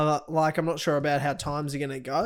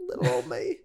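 mock sob about being picked on; mock self-pity. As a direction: play the poor victim, hammed-up.

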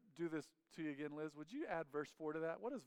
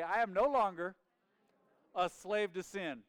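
loud, raised call with a weighty NO; congregational declaration; 5 semitones higher, 9 dB louder than usual. Real, not acted. Preacher leading everybody to say it together, loud and firm. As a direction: lead the room in a bold declaration.

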